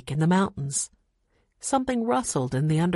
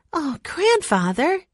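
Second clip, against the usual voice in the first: high, slow dismayed cry; 10 semitones higher, slower than usual. A narrator's reading. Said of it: "cried Jessie".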